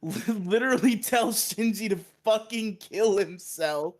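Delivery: laughing as she retells the shocking plot twist; laughing disbelief. Real, not acted.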